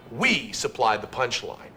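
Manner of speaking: ordinary talk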